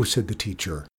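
plain reading voice

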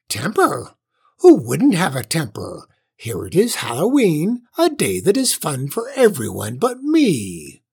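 aggrieved witch voice with wild pitch swoops; indignant old witch. A narrator's reading. Witch griping that Halloween leaves her out, indignant. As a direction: complain hotly as a cross old witch.

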